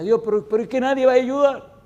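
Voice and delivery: a desperate cry that nobody will help; crying out to God. Retold or imitated. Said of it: "Y llorando y llorando".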